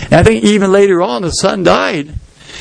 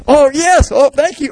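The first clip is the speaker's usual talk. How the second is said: much higher, gushing mock thanks; grateful widow; 9 semitones higher than usual. Widow thanks the man of God, high and gushing. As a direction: gush with relieved gratitude. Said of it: "her attitude might be".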